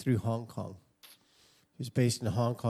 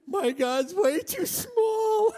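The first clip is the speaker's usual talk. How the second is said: much higher, slow, half-crying lament; young self's dismay; 7 dB louder, slower than usual. Retold or imitated. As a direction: wail it like a stricken teenager. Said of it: "they were like".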